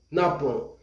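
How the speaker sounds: soft falling "napon". Shown as a demonstration. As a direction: say "napon" as na-PON, nasal ending, quietly. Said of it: "Vamos repetir".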